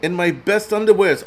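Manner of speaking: high-pitched parody of a Ukrainian pleader; mock desperate Ukrainian accent. Streamer parodies a desperate leader groveling, mock Ukrainian accent. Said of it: "his Ukrainian accent".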